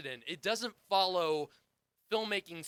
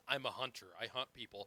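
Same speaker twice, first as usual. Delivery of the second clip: lower, softer deadpan hunter line; mock Kraven; 6 semitones lower, 10 dB quieter than usual. Podcast host mocking Kraven's only line, lower and softer. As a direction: flatly intone it, a notch lower and softer. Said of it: "all he says in the movie is".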